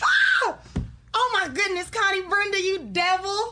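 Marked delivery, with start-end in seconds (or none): 0.0-0.6 sudden high shriek of fright; startled scream. Acted. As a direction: scream as if jumped at. Startled by hidden CB.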